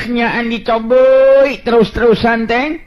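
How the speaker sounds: ordinary delivery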